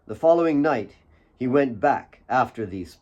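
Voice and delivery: usual speaking voice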